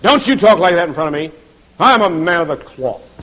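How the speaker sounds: loud, pompous scolding; hammed-up, forceful reprimand; mock indignant rebuke. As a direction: play the self-important clergyman. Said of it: "I could say to them".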